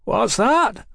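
high, rough shout; rough cry. A narrator's reading. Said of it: "Mallory cried roughly".

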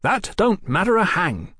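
high-pitched eager interjection; said quickly. A narrator's reading. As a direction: jump straight in, brushing it aside. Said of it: "Tom said quickly".

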